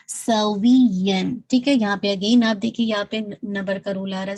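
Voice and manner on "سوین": سوین very slow, voice lifted on the stressed syllable; nabr emphasis. Shown as a demonstration. Teacher demonstrating nabr on سوین, slow. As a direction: raise the voice slightly in سوین. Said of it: "آپ کی آواز تھوڑی سی ایلیویٹ ہوگی".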